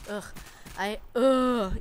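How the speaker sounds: a loud, real groan of disgust at the memory. Real, not acted. Recalling the bratty kids, a loud disgusted groan. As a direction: let out a loud ugh of disgust.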